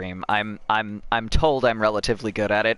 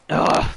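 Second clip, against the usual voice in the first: loud, exasperated "ugh"; frustrated groan; 6 dB louder than usual. Real, not acted. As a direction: groan loudly in defeat. Gives up on the shard.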